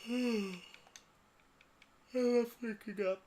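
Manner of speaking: squeaky high falsetto; sing-song high voice, mock cheerful; high silly voice. Sigh then high false voice.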